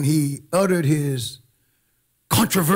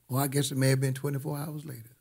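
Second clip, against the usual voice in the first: fast, softer afterthought; quick aside; 7 dB quieter, faster than usual. Real, not acted. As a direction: toss it off quickly under the main line. Quick self-correcting aside to dramatic line.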